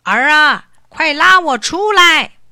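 high, loud, slow cry for help; old man shouting up from the barrel; calling out. A narrator's reading. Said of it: "老头子在桶里叫道". (老头子在桶里大声呼救)

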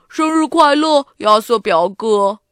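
little cousin's loud voice; loud character-voiced birthday greeting; 表弟祝贺. A narrator's reading. Younger cousin runs up with greeting. (表弟大声地祝贺生日)

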